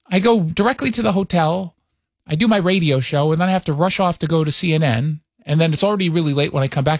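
ordinary talk

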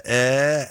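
"eh" as a short open vowel; accent turc imité. Shown as a demonstration. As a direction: isolate a drawn-out "eh" sound. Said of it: "there is a little bit of a".

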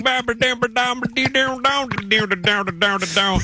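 high-pitched mock gibberish gliding down; unintelligible Southern gibberish. High school friend on speakerphone, imitated unintelligible Southern babble. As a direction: babble nonsense syllables in a high put-on voice. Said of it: "put one of those guys on speakerphone".

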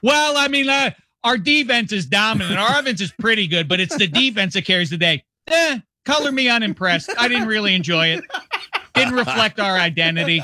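high, put-on voice of a shrugging fan; mock Arizona fan. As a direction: pitch it up like an unimpressed local.

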